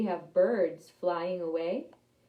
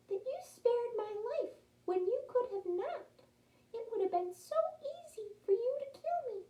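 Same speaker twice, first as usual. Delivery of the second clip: kind little mouse. Acted. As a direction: gently remind the lion of mercy. Mouse voiced in story.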